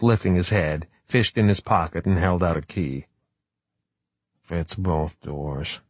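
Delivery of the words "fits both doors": muffled, slow and low. Acted. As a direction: speak into the table, muffled. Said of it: "in a muffled voice".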